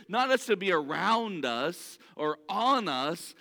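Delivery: ordinary delivery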